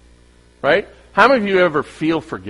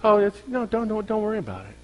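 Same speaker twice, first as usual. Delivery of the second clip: higher, fast and soft, dismissing the hurt; brushing off the hurt, higher and quicker; hurried brush-off; 6 semitones higher, 5 dB quieter than usual. Retold or imitated. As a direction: rush a falsely breezy reassurance. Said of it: "the person is trying to say".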